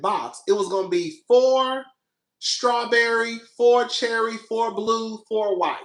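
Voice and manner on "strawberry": normal talking voice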